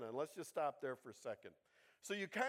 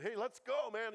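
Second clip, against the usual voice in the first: higher, louder pep-talk call; jacked-up rallying; 5 semitones higher, 5 dB louder than usual. Acted. Jonathan rousing his armor bearer, jacked up. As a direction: fire up a buddy to head out. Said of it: "gets them all jacked up".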